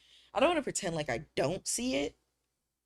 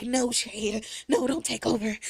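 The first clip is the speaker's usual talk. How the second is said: mock inner struggle; 6 semitones higher, 4 dB louder than usual. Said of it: "I'm like".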